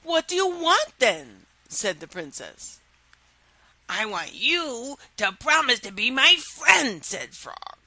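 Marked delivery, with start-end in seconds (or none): 0.0-1.5 high, louder princess; high impatient princess. Acted. Princess voiced in story. 3.9-7.0 frog in a changed timbre. Acted. Frog voiced in story.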